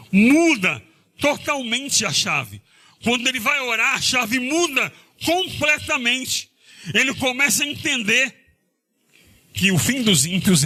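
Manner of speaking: fervent pulpit emphasis; emphatic preaching. Acted.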